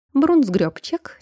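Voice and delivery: usual speaking voice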